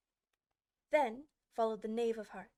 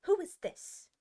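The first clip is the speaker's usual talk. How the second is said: high, loud, severe queen; severe demand; 9 semitones higher, 7 dB louder, slower than usual. Acted. Queen confronting Alice, loud and severe. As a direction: snap it out like a stern queen. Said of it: "the queen said severely".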